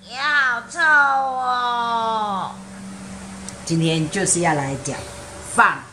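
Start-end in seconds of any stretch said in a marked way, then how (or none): 0.1-2.5 long, slow cry of disgust, pitched up; disgusted drawn-out shout. Acted. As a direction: groan out how stinky it is. Reacting to smelly fart in story.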